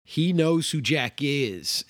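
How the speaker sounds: usual speaking voice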